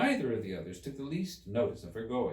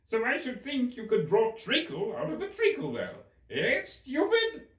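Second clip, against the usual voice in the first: Hatter voice; 5 dB louder than usual. Acted.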